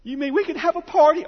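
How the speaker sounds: put-on high excited child voice; a teary boy suddenly hopeful; sermon story, the boy perks up at a party, high and eager; little boy's delight. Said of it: "he says".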